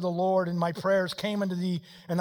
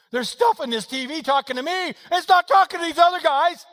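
loud, high, driving preacher delivery; raised-voice pulpit exhortation; full-force preaching; 12 semitones higher, 11 dB louder than usual. Acted. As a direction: preach it at a shout, urgent and relentless.

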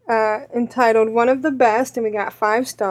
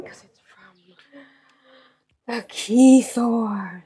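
slow conspiratorial whisper; much slower than usual. Real, not acted. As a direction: breathe it like a secret. Aside about the message sender.

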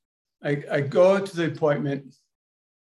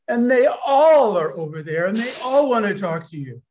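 excited; 6 semitones higher, 5 dB louder than usual. Retold or imitated. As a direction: pitch jumps higher and louder, eager. Spirits crowding in, told excitedly.

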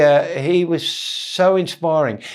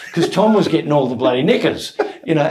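ordinary delivery

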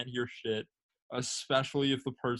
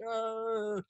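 put-on drunken groan; 10 semitones higher, 4 dB quieter than usual. Drunk friend lifting his head.

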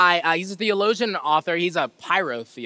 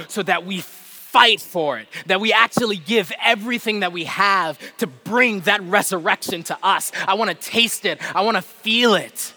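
rising, louder preaching push; 5 semitones higher, 6 dB louder than usual. Acted. Preacher rallying the congregation to fight, louder preaching. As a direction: urge the congregation on, voice climbing. Building passion in sermon.

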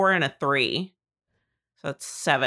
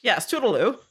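prim farewell voice; 4 semitones higher than usual. Acted. In-character farewell.

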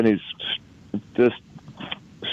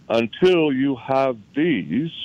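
slow, weighty, each word set down; slow deliberate; 3 semitones higher, slower than usual. Retold or imitated. Mentor lecturing the group on gold, slow and deliberate. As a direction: lay it out slowly like a lesson.

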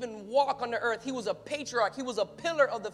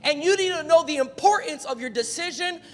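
louder, rising preacher's exhortation; full-force preaching; 3 semitones higher, 9 dB louder than usual. Acted. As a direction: drive it home to the congregation.

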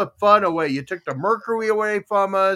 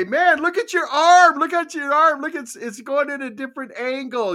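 mock kid amazement; 9 semitones higher, 4 dB louder than usual. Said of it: "you know, and say".

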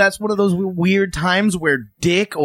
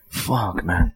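much lower and softer, defeated; deflated sigh; 11 semitones lower, 3 dB quieter than usual. Retold or imitated. Friend imagining the brother alone with a drink, low and defeated. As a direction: let it sink out of you. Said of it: "have a drink and go".

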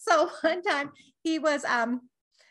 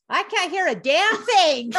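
loud deaf reply; 5 semitones higher, 7 dB louder, slower than usual. Retold or imitated. Deaf dad answering at funeral.

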